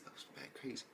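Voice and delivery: breathless whisper of disbelief; stunned whisper; whispered awe. Real, not acted. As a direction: hush it like you can't believe it. Stunned reaction to the scene.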